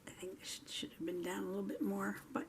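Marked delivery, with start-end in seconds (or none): 0.1-0.7 soft unvoiced whisper to herself. Real, not acted. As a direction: breathe it quietly, half to herself. Muttering to self while crafting.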